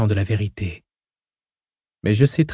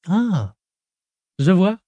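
higher, light teasing woman; young woman voice; 8 semitones higher, slower than usual. Acted. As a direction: voice Hui playful and light. Hui's voice.

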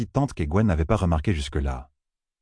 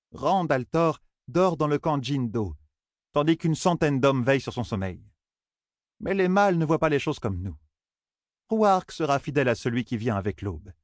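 even, raised-pitch character voice; matriarch explaining; 6 semitones higher than usual. Acted.

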